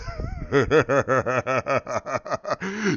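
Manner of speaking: imitated long, gleeful laugh; Thor. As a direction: laugh on and on like a delighted warrior. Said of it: "he starts laughing".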